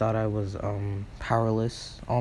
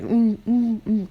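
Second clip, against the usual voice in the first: high, loud hummed squeals; muffled cries with a hand over the mouth; muffled scream; 12 semitones higher, 7 dB louder, slower than usual. Acted. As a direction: scream through a covered mouth. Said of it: "Nezu was screaming".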